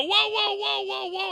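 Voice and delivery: high, urgent whoa whoa; high pleading whoas to stop a fight; calming whoas. Retold or imitated. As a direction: hold him off with high whoas. Calming an angry wrestler.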